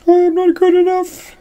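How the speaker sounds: very high, loud squeal; playful silly voice. Shown as a demonstration. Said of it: "what other ways you could say".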